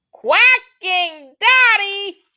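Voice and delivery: high, slow, blaring shout; very loud. Shown as a demonstration. Teacher demonstrating the duck's volume, loud shout. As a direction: blast it out to outdo the quail. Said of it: "it's not as loud as".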